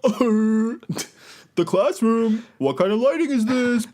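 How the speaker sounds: high-pitched YouTuber reaction voice; over-the-top YouTuber commentary; fast talker YouTuber voice. Acted. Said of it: "my fast talker YouTuber voice".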